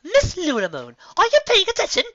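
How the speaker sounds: high, loud indignant yell; shrill-free loud high scolding. Acted. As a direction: snap her back to attention. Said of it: "Rarity yelled".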